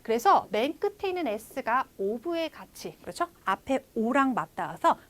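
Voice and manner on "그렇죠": usual speaking voice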